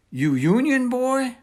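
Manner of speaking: mock the boy with a sneer; jeering. A narrator's reading. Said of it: "one jeered".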